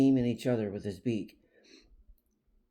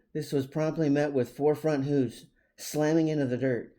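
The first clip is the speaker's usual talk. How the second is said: plain reading voice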